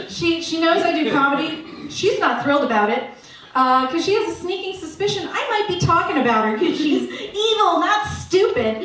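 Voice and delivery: ordinary talk, nothing marked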